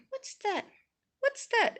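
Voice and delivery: high-pitched, puzzled self-quote; puzzled curiosity. Retold or imitated. Viewer recalling a puzzling film moment, high and curious. Retelling own puzzled reaction to movie.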